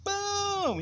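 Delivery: long, high-pitched "boom" for a blow landing; booming hit. Retold or imitated. Storyteller acting out a stick fight, booming hit. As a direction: draw out the impact sound high.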